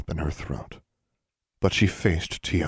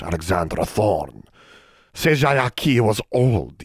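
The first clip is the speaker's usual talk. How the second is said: higher, solemn accusing elf voice; a stern elder passing judgment; elf leader; 6 semitones higher, 4 dB louder than usual. Acted.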